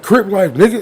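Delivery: a little kid acting tough; mock kid bravado. Kid throwing up a gang shout at the driver, mock tough.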